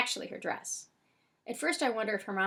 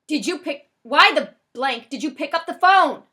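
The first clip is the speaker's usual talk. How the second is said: high, loud exasperated demand; angry yelling; 6 semitones higher, 15 dB louder than usual. A narrator's reading. Frosty interrupts yelling about the phone, furious shout.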